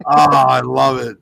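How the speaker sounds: normal talking voice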